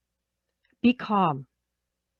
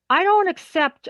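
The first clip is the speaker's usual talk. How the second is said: strict boss; 8 semitones higher, 5 dB louder than usual. Acted. Acting a strict difficult boss.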